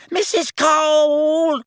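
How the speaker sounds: high, long-drawn loud call; bellowing. A narrator's reading. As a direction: bellow for someone in another room. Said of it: "she bellowed over her shoulder".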